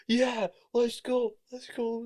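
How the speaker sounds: imitated crowd of teammates cheering; team cheering. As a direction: cheer a teammate over the line. Team cheering him at the finish.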